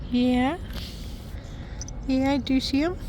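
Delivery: high, sweet rising reply. Real, not acted. Chatting with her child about the squirrel, high cooing. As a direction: coo it high, lilting up at the end.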